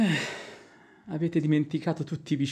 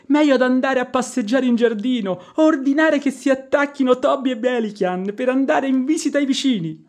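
louder and higher, pleading in frustration; exasperated outburst; 6 semitones higher, 6 dB louder than usual. Acted. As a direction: burst out at her to get out. Servant loses patience with mistress.

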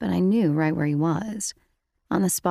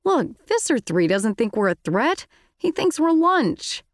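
higher sardonic Marco; Marco's voice; 6 semitones higher than usual. A narrator's reading. Marco to Rachel, scoffing at their chances.

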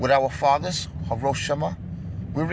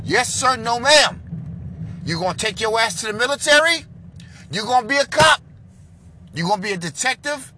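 loud, high drill-sergeant father; louder, higher stern father; stern father barking; 6 semitones higher, 5 dB louder than usual. Retold or imitated. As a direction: bark it like a strict dad demanding respect. Voicing strict father drilling son.

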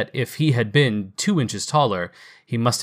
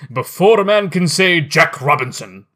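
much higher and louder hearty holler; jovial shout; 5 semitones higher, 7 dB louder than usual. Acted. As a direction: call out the boys like a jolly master. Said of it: "cried old Fezziwig".

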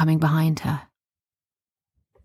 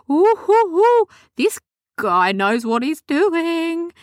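high, slow hooting awe; awed delight; 12 semitones higher, 3 dB louder, slower than usual. Acted. Chemist holding the crystal, high and awestruck. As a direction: marvel at the crystal.

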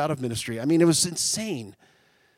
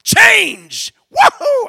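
hammed-up shouting joy, much higher and louder; excited whoop; 13 dB louder, much slower than usual. Retelling his joy at office moves, mock ecstatic shout. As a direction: whoop it up like a kid at a party. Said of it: "And I'm like".

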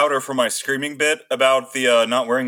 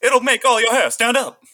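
much higher, eager ad announcer; old fifties salesman voice; 9 semitones higher, 4 dB louder than usual. Retold or imitated. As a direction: pitch it like a cheesy commercial. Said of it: "old nineteen fifties salesman voice".

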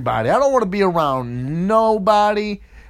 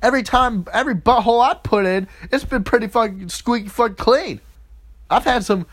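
ordinary talk

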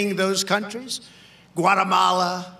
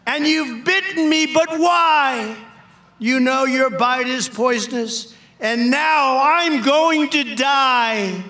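higher, louder pleading of the bitten woman; dying woman's cry; 5 semitones higher, 5 dB louder, slower than usual. Acted. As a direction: mourn her own death aloud, slowly at the end. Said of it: "cried the woman".

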